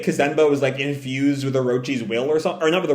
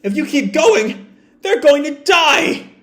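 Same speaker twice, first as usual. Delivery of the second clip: much higher, desperate yelling; calling out; 11 semitones higher, 3 dB louder than usual. Retold or imitated. Crews calling out to Big Mom, desperate high yell. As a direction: plead loudly with her. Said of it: "people call out to Big Mom".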